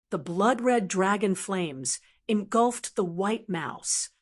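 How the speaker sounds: ordinary delivery, nothing marked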